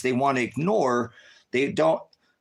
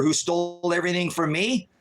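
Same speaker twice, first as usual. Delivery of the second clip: ordinary talk, nothing marked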